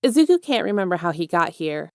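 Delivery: usual speaking voice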